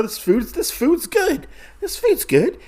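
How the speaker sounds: high, laughing nervous shopkeeper. Retold or imitated. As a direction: laugh it off anxiously to the thugs.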